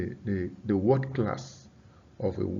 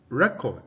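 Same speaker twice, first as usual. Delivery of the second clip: RECord, first syllable stressed, second short; 3 dB louder than usual. Shown as a demonstration. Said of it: "the stress is on the first syllable".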